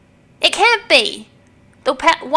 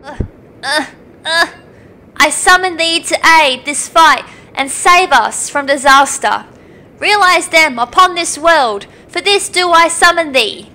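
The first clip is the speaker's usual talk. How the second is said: solemn incantation. Acted. Priestess summoning the dragon to save the world, solemn chant. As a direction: call on the ancient dragon like a priestess.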